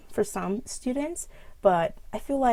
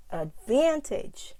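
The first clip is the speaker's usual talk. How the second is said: advantage with VAN high and stretched; adVANtage, long middle syllable; stress on VAN; 6 semitones higher, much slower than usual. Shown as a demonstration. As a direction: slowly punch the VAN in advantage. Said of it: "word stress".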